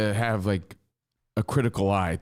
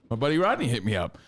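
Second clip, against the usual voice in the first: usual speaking voice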